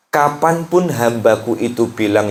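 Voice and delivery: ordinary talk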